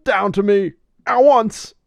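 high, loud Batman impression; Adam West Batman. Batman quote, Adam West style.

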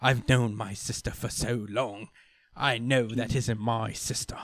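measured, slightly slowed dramatic voice; Captain Britain. Retold or imitated. Brother assuring Rogue he knows his sister, solemn delivery. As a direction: say it slowly and earnestly. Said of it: "Captain Britain is like".

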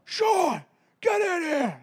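high, shouted call from down the block; dad's booming voice. Retold or imitated. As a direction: bellow like an angry dad calling his kid. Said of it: "has that booming voice".

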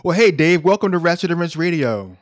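booming, high-spirited welcome; loud cheerful greeting. Real, not acted. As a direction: call out the welcome with big energy.

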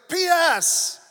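hammed-up indignant proclamation; Gabriel, furious. Angel adding a postscript to Zechariah, furious. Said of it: "The angel gets furious".

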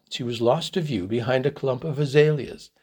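ordinary delivery, nothing marked

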